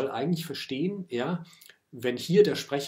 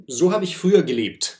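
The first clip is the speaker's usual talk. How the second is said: slightly acted, louder and higher declaration; lyrical speaker's voice; 5 semitones higher, 10 dB louder than usual. Lyrical I looking back on its former life, mildly declamatory. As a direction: quote the poet's persona with raised, emphatic voice. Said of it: "sagt das lyrische Ich".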